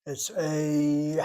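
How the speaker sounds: long, loud gliding "a"; diphthong sound. Shown as a demonstration. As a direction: stretch "a" into an ay-ee glide. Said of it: "is like a diphthong sound".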